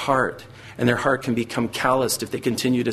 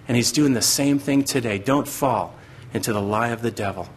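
ordinary talk

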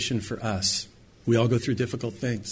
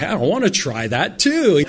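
drawn-out, louder mock enthusiasm; hammed-up eagerness, pitched up; eager volunteer; 5 semitones higher, 5 dB louder, slower than usual. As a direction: play someone keen to have a go. Voices an eager would-be water walker.

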